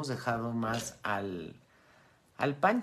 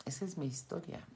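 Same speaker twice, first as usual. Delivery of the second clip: mock self-pity; 7 semitones higher, 7 dB quieter than usual. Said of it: "Y digo".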